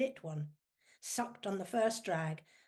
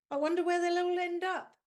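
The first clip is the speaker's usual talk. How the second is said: gleeful tease; 10 semitones higher, 7 dB louder than usual. Acted. [name] teasing about her prank.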